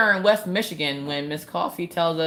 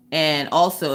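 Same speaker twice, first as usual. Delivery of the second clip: usual speaking voice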